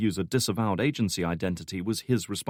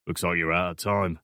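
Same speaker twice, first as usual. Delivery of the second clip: lightly voiced Cockney stranger; offhand Cockney handover; Cockney accent; 2 semitones lower than usual. A narrator's reading. Stranger handing Alex a phone, offhand Cockney accent. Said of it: "thick Cockney accent".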